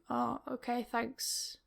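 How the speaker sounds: soft, sleepy mumble; a drowsy reply to bad news, very soft; half asleep. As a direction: answer like you're barely awake. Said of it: "half asleep and just being like".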